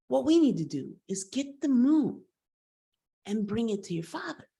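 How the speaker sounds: acted eager announcement; eager proposal. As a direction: pitch the big plan with excitement. Eagle tells boy the plan.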